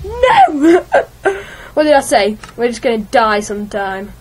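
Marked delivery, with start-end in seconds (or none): 0.0-0.9 high, loud cry of no; anguished no. Real, not acted. Gamer dies in Minecraft again, loud despairing cry. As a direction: wail it in despair.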